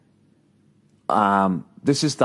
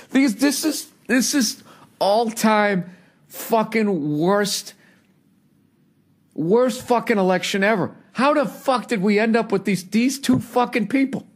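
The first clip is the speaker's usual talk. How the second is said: exasperated disbelief; 11 semitones higher than usual. Real, not acted. Disbelief rant about two candidates.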